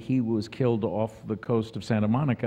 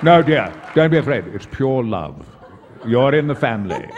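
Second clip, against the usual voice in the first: grand stage-actor impression, projecting; Patrick Stewart; 7 dB louder than usual. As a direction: soothe grandly like a veteran thespian. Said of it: "and Patrick said".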